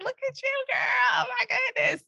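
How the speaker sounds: very high, squealed self-hype; retold high-pitched delighted cheer; cheering herself on. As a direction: squeal like your own hype girl. Retold cheering herself on while storytelling.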